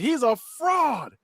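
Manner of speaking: high, long-drawn accusing voice; mock accusation. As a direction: stretch it out high, imitating the accuser. Voices the girl accusing Greg.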